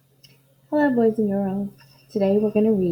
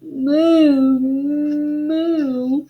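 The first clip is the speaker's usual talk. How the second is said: high louder mooing cow; 4 semitones higher, 5 dB louder than usual. Acted. Confused cow lowing in the barn, loud moo. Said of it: "who muttered".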